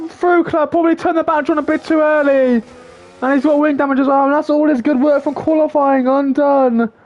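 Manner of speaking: quick, lively race commentary; live play-by-play. Acted. Calling the race start for viewers, lively play-by-play. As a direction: call the race as it unfolds.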